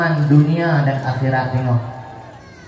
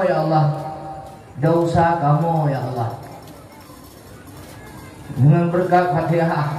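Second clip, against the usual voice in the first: leading a prayer. Real, not acted. Leads the closing prayer.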